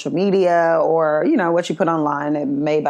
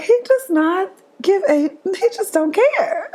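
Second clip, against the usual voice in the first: high, slow amazed quote; 12 semitones higher, slower than usual. Retold or imitated. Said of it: "you just be like".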